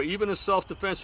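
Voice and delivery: ordinary talk, nothing marked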